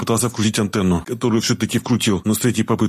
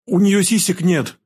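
put-on high, drawn-out girlish outcry; girl's exclamation; 9 semitones higher, slower than usual. A narrator's reading. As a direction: exclaim in a raised girl's voice, dragging the words. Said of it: "воскликнула девушка". (удивлённый возглас девушки высоким голосом)

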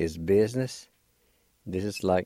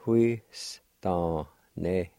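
slow drawn out; 2 semitones lower, much slower than usual. Shown as a demonstration. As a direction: stretch huistaneti slowly. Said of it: "Pronounced like this".

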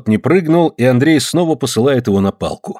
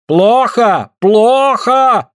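high, drawn-out cry of disapproval; shouted complaint; 11 semitones higher, 4 dB louder, much slower than usual. A narrator's reading. Suль complains to Afanasy about the miss, shouting high. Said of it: "кричит Суль Афанасию".

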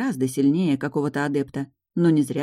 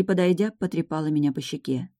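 ordinary delivery, nothing marked

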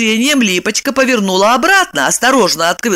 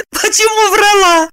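laughing; 13 semitones higher than usual. Acted. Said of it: "рассмеялась Липочка".

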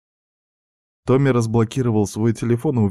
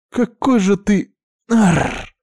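much higher, louder frustrated growl; exasperated anger; 14 semitones higher, 7 dB louder, much slower than usual. A narrator's reading. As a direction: choke on your own anger. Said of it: "сокрушённо злилась Арина".

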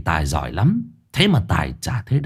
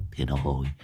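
put-on hushed call to the boy; whispering; 7 semitones lower, 8 dB quieter, slower than usual. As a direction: murmur his name under your breath. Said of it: "Miệng lão thì thầm".

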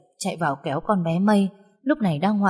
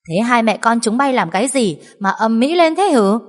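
put-on irritated old woman calling out; scolding grandmother; 5 semitones higher, 5 dB louder than usual. A narrator's reading. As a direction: snap at the noisy household. (bà mắng gắt)

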